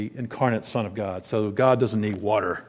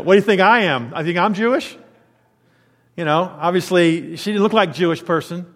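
louder, higher sassy comeback; sarcastic retort; 6 semitones higher, 3 dB louder than usual. Retold or imitated. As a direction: needle the stranger with mock disbelief. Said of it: "she gets a little bit sarcastic with him".

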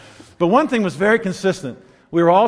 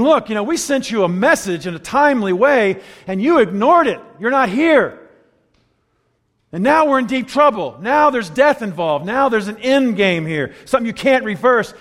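raised, pointed rebuke to Jesus; accusatory; 6 semitones higher, 5 dB louder than usual. Retold or imitated. Grieving sister confronting Jesus, accusing and loud. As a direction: put your finger in his face. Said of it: "It's accusatory".

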